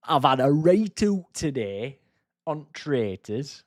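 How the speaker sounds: slow, lightly put-on Yorkshire accent; mock Yorkshire accent. Repeating the Traitors woman's line in a mild Yorkshire accent. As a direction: drawl it like the Yorkshire contestant again. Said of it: "proper thick Yorkshire accent".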